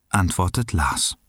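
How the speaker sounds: usual speaking voice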